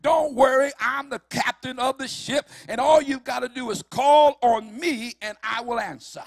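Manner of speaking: loud, high preaching cry; full-force preaching. Acted. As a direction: preach it out to the whole church. Preacher voicing God's promise.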